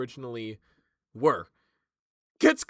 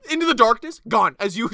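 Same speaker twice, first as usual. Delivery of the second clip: ordinary talk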